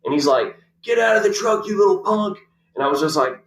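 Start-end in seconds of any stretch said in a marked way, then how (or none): 0.8-2.4 high-pitched imitation of an angry confrontation; mock angry order. Big man confronting the driver, mock angry. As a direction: order him out, hammed-up and high.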